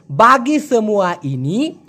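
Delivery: বাগি সেমুয়া ইনি spoken slowly, syllable by syllable; slow Malay demo. Shown as a demonstration. Teacher modelling the Malay for Bengali students, slow. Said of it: "ওরা বলে".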